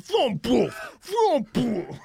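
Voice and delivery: loud, high vroom boom engine sounds; shouted engine noises. Retold or imitated. Imitating a passerby watching the skater crash, loud high vroom noises. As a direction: yell the vroom boom like a revving bike. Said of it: "He's like".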